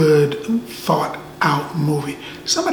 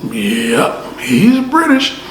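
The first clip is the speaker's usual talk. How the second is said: acted confirmation; much slower than usual. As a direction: play up the confirmed discovery, slowly. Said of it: "I was like".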